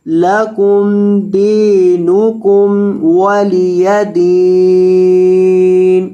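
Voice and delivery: slow, melodic Quranic chant; Quran recitation. Acted. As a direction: recite it measured and drawn out. (धीमी सुरीली तिलावत)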